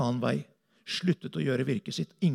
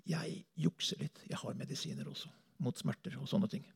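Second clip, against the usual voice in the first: hushed aside; 9 dB quieter than usual. Real, not acted. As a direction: let it slip quietly, almost to yourself. Confiding aside about pain medicine. (lavmælt, nesten hviskende tilståelse)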